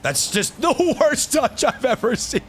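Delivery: laughing through the words, slowed down; amused disbelief at a terrible play. Real, not acted. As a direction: crack up as you mock the touch. Laughing at teammate's bad touch.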